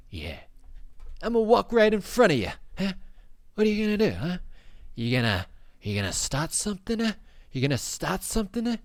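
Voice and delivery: high, loud put-on New York tough guy; tough New York accent. A stranger taunting him in a put-on New York accent. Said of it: "I don't know what accent that was".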